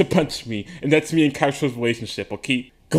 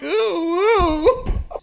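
mock swoon; 12 semitones higher than usual. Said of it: "I'll be like".

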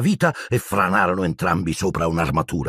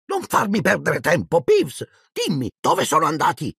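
angry caretaker; 10 semitones higher than usual. A narrator's reading. Filch demanding an answer.

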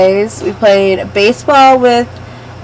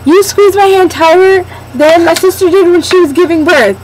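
high-pitched friend voice; 9 semitones higher than usual. Retold or imitated. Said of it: "she goes".

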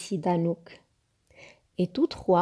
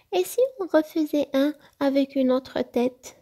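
little fox suggesting; 6 semitones higher than usual. Acted.

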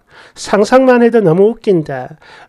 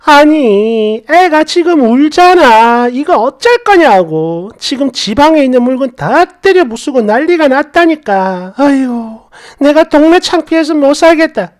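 angry complaining; 4 semitones higher than usual. Retold or imitated. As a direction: wail at her in mock fury. Sister-in-law keeps yelling.

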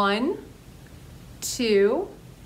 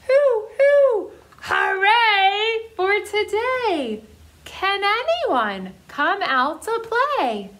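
high, loud owl hooting, then cheering; little owl calling into the night to play; 9 semitones higher, 4 dB louder, slower than usual. Acted. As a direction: hoot it out like a happy little owl. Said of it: "So she goes".

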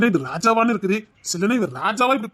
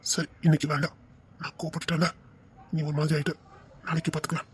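soft apology; 4 semitones lower, 9 dB quieter than usual. Acted. Husband apologizes softly after quarrel.

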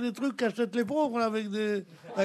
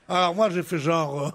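normal talking voice

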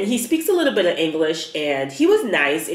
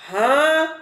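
baffled disbelief; 8 semitones higher than usual. Real, not acted. Baffled at her situation.

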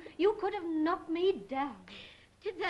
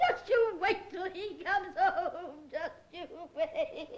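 tearful threat. Acted. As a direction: threaten him through your tears. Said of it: "don't cry".

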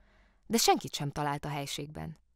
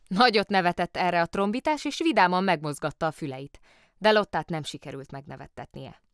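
plain reading voice

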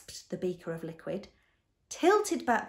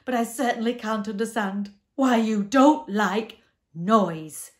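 big, raised monster voice; loud protest; 4 semitones higher, 12 dB louder than usual. A narrator's reading. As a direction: protest in booming, emphatic words. Upset Din protests to doctor.

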